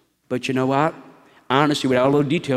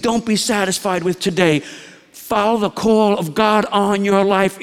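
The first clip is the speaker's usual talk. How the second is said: fervent preaching; 5 semitones higher than usual. Acted. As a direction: preach it out, pressing the congregation. Builds into full preaching.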